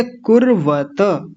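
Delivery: कुर्वत very slow with full final त; correct form. Shown as a demonstration. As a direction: end कुर्वत on an open a. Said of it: "कुर्वत नहीं बोलेंगे".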